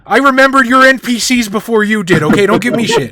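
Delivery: loud, high-pitched bragging; playful defiant outburst, voice shooting up; mock indignant boast. Real, not acted. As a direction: crow over your friend, loudly. Player crows about remembering the names.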